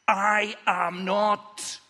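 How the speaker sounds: very slow, stretched-out denial; acted stubborn protest, dragged out; emphatic denial. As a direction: deny it one drawn-out word at a time. Peter's third denial, acted.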